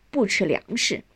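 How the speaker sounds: usual speaking voice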